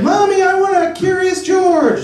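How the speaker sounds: put-on very high child's whine; little kid. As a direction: beg like a small boy. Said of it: "I'd be like".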